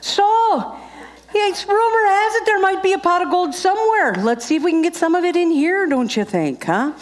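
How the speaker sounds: high, lilting mock Irish accent; hammed-up Irish lilt, pitched high; homemade Irish accent. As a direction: coax the crowd in a playful Irish brogue. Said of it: "what my husband calls this accent".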